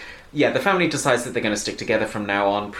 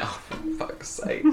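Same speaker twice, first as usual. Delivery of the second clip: whispered, much softer exasperation; exasperated whisper; 11 dB quieter than usual. Retold or imitated. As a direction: mutter it under your breath in disbelief. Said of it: "And I'm like".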